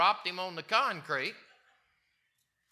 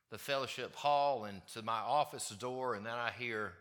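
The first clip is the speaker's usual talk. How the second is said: ordinary talk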